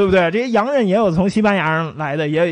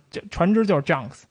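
normal talking voice

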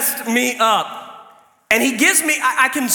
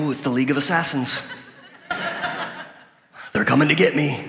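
mock panic; 5 semitones lower, 3 dB quieter, faster than usual. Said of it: "instantly I'm like".

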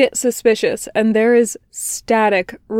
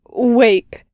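louder, urgent plea; panicked wait; 3 semitones higher, 5 dB louder than usual. A narrator's reading. Patient stopping his therapist from hanging up, panicked and loud. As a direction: blurt it out before she hangs up.

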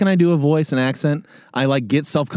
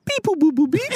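much higher chirpy mock droid beeps; imitating robot beeps; 13 semitones higher than usual. Retelling a classmate's bad R2-D2 impression, high robot beeps. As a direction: beep like a toy robot. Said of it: "would go".

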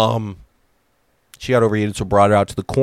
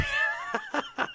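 high, squeaky wheezing laugh; breathless high-pitched laugh; 11 dB quieter than usual. Real, not acted. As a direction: crack up at your own bad odds. Laughs at own ticket sales prospects.

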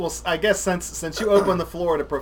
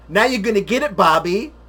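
loud, higher Happy Gilmore taunt; Adam Sandler movie line; 3 semitones higher, 8 dB louder than usual. Retold or imitated. Quoting Happy Gilmore to a friend, loud taunting yell. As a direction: yell it like you're about to throw a punch. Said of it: "the time that Adam Sandler beat up Bob Barker".

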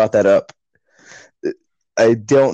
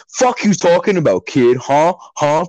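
higher-pitched mock confrontation; defensive husband; 5 semitones higher than usual. Husband defending wife against teens, mock defensive bluster. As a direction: square up as a blustering Chad. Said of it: "her husband got all defensive".

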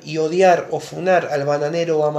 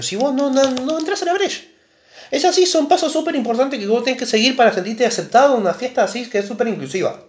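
mucho más agudo, parodia exaltada; voz aguda burlona; 8 semitones higher than usual. Acted. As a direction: exagera la regla en tono alto. Parodia sube de tono. (voz aguda de parodia)

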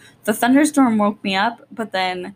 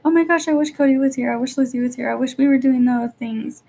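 higher, pleading wistful sigh of a missing mom; lightly acted homesick mom; wistful longing; 5 semitones higher, 3 dB louder than usual. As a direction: ache for them out loud, mock-tearful. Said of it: "I'm probably gonna be like".

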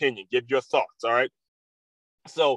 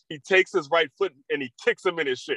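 normal talking voice

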